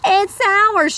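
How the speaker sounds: a hint of a shout. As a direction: call it out like a frantic parent. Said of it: "shouted Gabbler".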